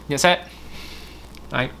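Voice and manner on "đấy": ordinary delivery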